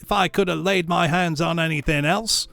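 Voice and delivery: brazen thief, unashamed. Acted. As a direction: raise the voice high and dare them. Charwoman urges Joe to open bundle.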